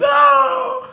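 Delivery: high squealing panicked shout; shrill mock outcry. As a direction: shriek it like a panicking crowd. Mocking the public's outcry.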